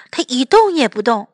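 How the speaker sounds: plain reading voice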